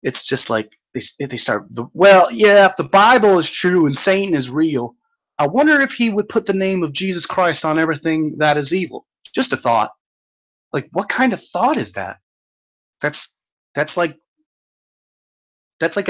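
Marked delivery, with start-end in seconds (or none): 1.9-9.9 higher and louder, a sneering parody of the troll; mock pious voice. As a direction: ham up the preachy reasoning, pitched up.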